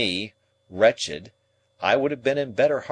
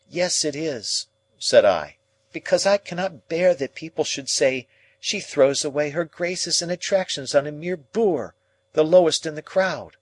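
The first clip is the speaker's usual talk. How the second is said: louder, higher pleading protest; heated plea; 7 semitones higher, 4 dB louder than usual. A narrator's reading. Pip to Estella, pleading against Drummle, heated. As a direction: press her hard, voice rising.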